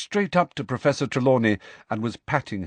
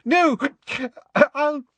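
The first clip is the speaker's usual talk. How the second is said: tearful, hysterical Trelawney. Acted. Sacked seer offering to leave, high and tearful. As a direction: quaver it out dramatically through tears.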